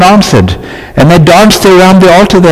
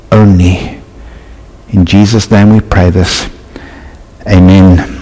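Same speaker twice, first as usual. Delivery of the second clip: prayer; 4 semitones lower than usual. Real, not acted. Pastor praying over the congregation, low and hushed. Pastor prays after reading.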